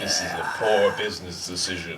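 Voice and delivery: low, hammy Vader voice; bad acting. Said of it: "Worst acting ever".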